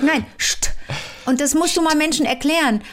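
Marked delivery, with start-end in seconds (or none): 0.4-0.7 "Scht" with a hushing sh before the t; Scht sound. Shown as a demonstration. Showing learners how S and T sound, hissed sh-t in "Scht". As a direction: demonstrate "Scht" as a sh sound closed by t. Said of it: "und ihnen gezeigt".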